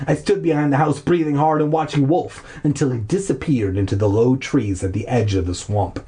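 plain reading voice

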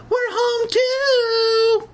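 very high, drawn-out falsetto call; mock woman's voice. A narrator's reading. Conan's mother arriving home, exaggerated high woman's call. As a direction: trill it slowly, way up high. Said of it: "It was a woman's voice".

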